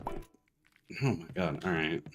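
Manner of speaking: ordinary delivery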